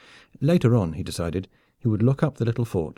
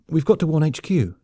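higher, urgent plea; gasped; 6 semitones higher, 3 dB louder than usual. A narrator's reading. Soldier urging his friend to act, gasped. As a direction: urge action in alarm. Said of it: "Eddie gasped".

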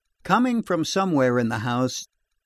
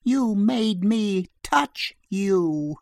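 slow bitter accusation; 6 semitones higher, much slower than usual. A narrator's reading. Mrs Sloane accusing the monster.

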